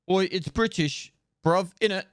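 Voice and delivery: a cheeky mock Londoner; British slang voice. Streamer joking to chat, in a light mock British accent. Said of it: "You know, is British".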